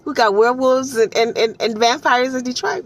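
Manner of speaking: high, disbelieving questions; incredulous. Retold or imitated. Detroit native reacting in mock disbelief. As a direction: act shocked at werewolves in Detroit. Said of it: "So I was like".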